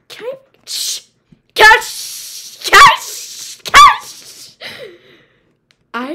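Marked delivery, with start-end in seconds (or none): 0.1-4.6 shushing getting louder. Pretend. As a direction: shush rising higher and louder each time. Escalating shushing at kids.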